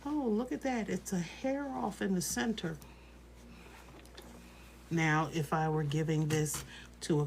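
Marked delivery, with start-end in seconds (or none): 0.1-2.8 higher, softer mock fussing; mock worried nitpicking, pitched up; imagined nitpicker. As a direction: lightly mimic someone spotting a tiny flaw. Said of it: "is not gonna be sitting there worrying about".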